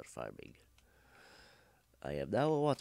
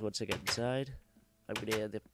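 ordinary talk

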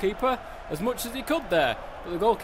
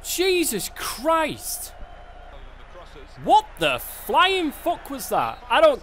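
higher, louder, shouting at the team; frustrated yelling; 8 semitones higher, 6 dB louder than usual. Real, not acted. Player shouting at his own defenders on screen, real angry yelling. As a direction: bellow at players who won't track back.

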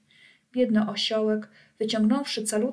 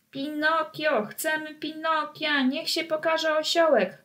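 put-on higher children's calling; boys shouting; 7 semitones higher than usual. Boys in the audience calling for the donkey, higher. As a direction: call like kids in the stalls. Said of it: "krzyczeli chłopcy z parteru".

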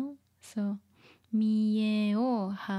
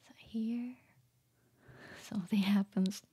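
whisper; 4 dB quieter than usual. Real, not acted. Teacher whispering scene setup.

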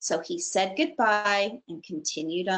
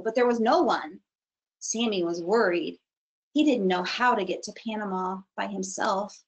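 ordinary delivery, nothing marked

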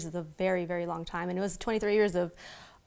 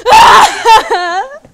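loud high crying cry out; crying wail; 22 dB louder than usual. Retold or imitated. Telling the interviewer her reaction to the coach, a loud crying wail. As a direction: break into a sobbing wail. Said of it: "And I just went".

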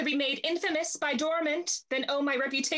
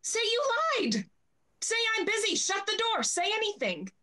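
flustered orders; 8 semitones higher than usual. Acted. Panicked orders to servant.

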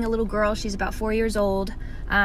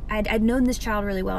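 ordinary talk, nothing marked

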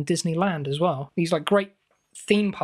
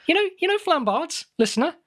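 excited; 11 semitones higher than usual. Acted. Pushing an obscure reference on listeners.

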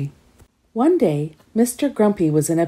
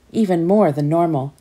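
ordinary delivery, nothing marked

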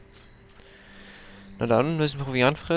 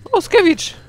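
much higher, louder squeak; high mock dismay; 9 dB louder, slower than usual. Real, not acted. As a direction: squeal at the game's joke. Spiel trollt ihn. (hohes gespieltes Entsetzen)

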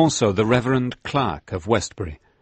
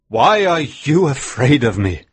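higher, louder challenge from the deathbed; dying old minister; 6 semitones higher, 5 dB louder than usual. Acted. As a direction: rise up and confront the mourners. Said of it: "he said".

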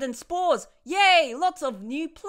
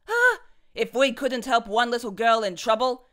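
plain reading voice

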